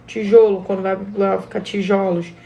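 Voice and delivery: "Tijolo" with a closed stressed o, then "tijolos" with an open stressed o. Shown as a demonstration. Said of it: "você sente essa diferença tônica".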